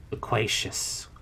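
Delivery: hollow unvoiced whisper of a word; hollow, whispered answer. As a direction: say it empty and hollow. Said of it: "in a hollow voice".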